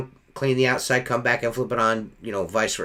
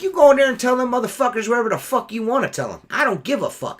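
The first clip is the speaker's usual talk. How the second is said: fast, high-pitched angry dare; retold defiant challenge; 8 semitones higher, faster than usual. Window cleaner daring customer to go complain, retold defiant challenge. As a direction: dare them heatedly, words rushing out.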